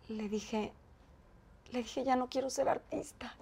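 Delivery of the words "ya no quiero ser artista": hushed, breaking confession; whispered confession. Retold or imitated. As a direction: whisper it as if about to cry. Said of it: "Y me puse a chillar en el coche". (confesión susurrada, a punto de llorar)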